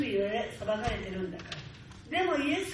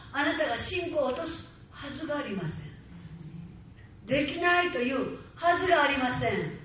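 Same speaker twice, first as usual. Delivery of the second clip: loud, high fiery exhortation; full-force preaching; 3 semitones higher, 3 dB louder than usual. Acted. Preacher rallying his congregation, loud and fiery. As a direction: drive it home to the congregation.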